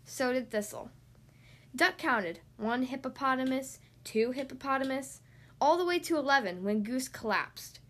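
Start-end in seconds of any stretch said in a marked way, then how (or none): none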